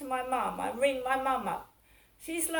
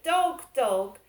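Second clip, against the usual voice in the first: mild mock shout, louder than the telling; retold kid's shout; 5 dB louder than usual. Kid shouting at a passer-by, mildly imitated shout. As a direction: imitate a kid calling out, only half shouted. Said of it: "those kids had shouted".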